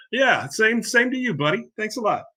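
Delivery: high-pitched sarcastic retort; sarcastically grateful, voice lifted high; sarcastic thanks. Real, not acted. Sarcastic retort to a heckling commenter.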